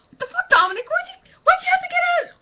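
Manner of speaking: high, loud furious shout at Dominic; acted shouting at friend. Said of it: "That's probably how it would act".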